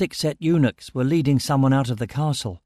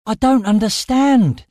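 loud, high-pitched shout; shouting over wind; 10 semitones higher, 7 dB louder than usual. A narrator's reading. Narrator shouts to Amira over rising wind, shouting. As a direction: holler to be heard above a storm. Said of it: "I shouted over the rising wind".

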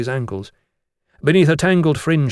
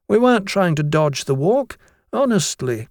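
lightly voiced higher-pitched schoolboy; schoolboy excuse; 6 semitones higher than usual. A narrator's reading. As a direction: plead innocence to the teacher, a touch earnest. Schoolboy excusing himself to teacher.

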